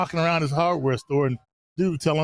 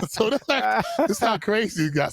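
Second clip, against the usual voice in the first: ordinary talk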